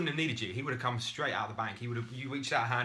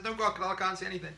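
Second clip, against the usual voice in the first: higher, fast brush-off; dismissive swimmer; 8 semitones higher, faster than usual. Retold or imitated. Swimmer brushing off his rescuer, higher and fast. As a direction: wave away the warning in a rush. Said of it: "and the guy says".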